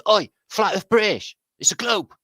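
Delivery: high, loud jeering shout of the lads; mates calling out. Retold or imitated. Local lads ribbing him about his name, calling out loud. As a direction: holler it like a teasing mate. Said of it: "the boys that round here".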